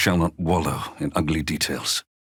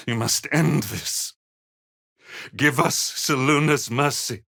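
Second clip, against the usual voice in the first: higher, strained plea for mercy; desperate plea; 8 semitones higher than usual. Acted. Father begs his daughter to end the torment, pleading. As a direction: beg for an end to it.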